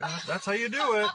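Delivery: high, loud sarcastic "oh"; mock the naive advice with fake surprise; sarcastic mock realization.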